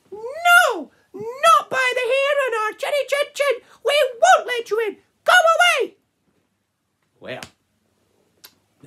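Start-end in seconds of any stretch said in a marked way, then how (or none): 0.1-6.0 very high, loud squeaky piggy voice; defiant piglets shrieking back; squeaky little pigs. Acted. Piggies refusing the wolf, very high squeaky voice.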